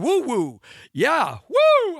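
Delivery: high, loud party holler; gleeful cheer; excited whoop. Acted. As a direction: whoop it up for a big Friday night. Whooping over the coming date.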